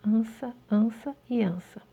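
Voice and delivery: ansa ansa e ansa whispered with no voice. Shown as a demonstration. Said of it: "Pra vocês destacarem e enfatizarem bem". (ansa sussurrado, sem voz)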